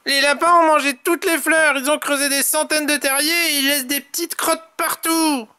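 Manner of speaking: high complaining character voice; dismayed Tom. A narrator's reading. Tom laments what the rabbits did, high dismayed voice. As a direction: reel off the damage high and aghast.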